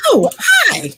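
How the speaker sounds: very high, loud squealed hello; excited greeting. Real, not acted. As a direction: call out a surprised, delighted hi.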